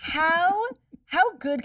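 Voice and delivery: rising disbelief; incredulous. Real, not acted. Incredulous rant.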